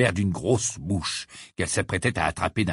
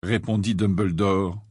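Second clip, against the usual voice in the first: ordinary delivery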